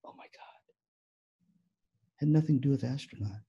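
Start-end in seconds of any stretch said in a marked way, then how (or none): 0.1-0.6 barely audible whisper; awed. Retold or imitated. Speaker struck by the dad's answer, awed whisper. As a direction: breathe it out, overwhelmed.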